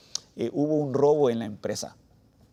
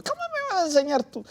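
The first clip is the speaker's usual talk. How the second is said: much higher, amazed mock outrage; incredulous mother. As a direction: play the mother, astonished and teasing. Mother retorts about teaching her.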